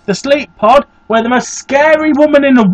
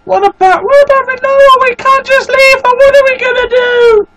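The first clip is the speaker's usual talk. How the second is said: high voiced game character, worried; a pitched-up hero fretting over Rinoa; 12 semitones higher than usual. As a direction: plead not to leave her behind. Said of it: "[name]'s voice has changed".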